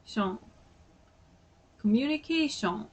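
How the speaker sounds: Japanese "komyunikeeshon", ending in a round "shon"; Japanese accent. Shown as a demonstration. Repeating the example word, in a Japanese accent. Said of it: "with the Japanese accent".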